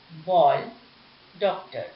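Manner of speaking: "बॉल" with the open English aw vowel; English ऑ sound. Shown as a demonstration. Teacher to pupils, showing borrowed ऑ: open aw in "बॉल". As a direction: round the vowel in "डॉक्टर" like English. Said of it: "ऑ के रूप में होता है".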